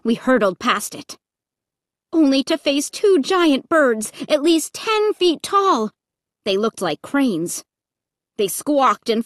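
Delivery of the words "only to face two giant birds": ordinary delivery, nothing marked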